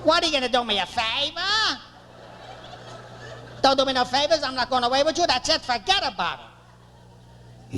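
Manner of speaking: high, scornful refusal; screaming wife. Retold or imitated. Wife refusing husband's favor, screaming. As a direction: imitate an indignant wife turning him down. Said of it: "She's screaming".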